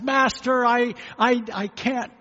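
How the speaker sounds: high, pleading, slowing at the end; begging servant. Acted. Indebted servant begs his master, high and pleading. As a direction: beg the master for mercy. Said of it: "came begging to his master".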